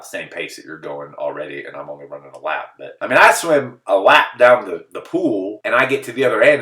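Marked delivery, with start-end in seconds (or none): none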